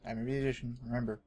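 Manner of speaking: usual speaking voice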